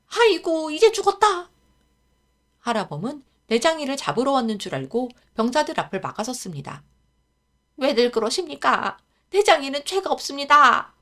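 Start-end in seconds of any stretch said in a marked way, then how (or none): 0.1-1.5 much higher, loud despairing wail; old groom fearing soldiers; panicked wail. Acted. Old man thinks boy arrested. 7.8-10.9 higher, loud pleading defence; old groom begging the soldiers; desperate plea. Acted. Old man blocks soldiers.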